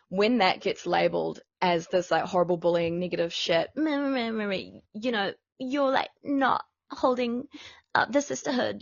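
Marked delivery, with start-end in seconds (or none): 3.8-8.8 higher, softly mocking parody; put-on whiny meh-meh mimicry of a scolder; mock the critics in a higher put-on voice.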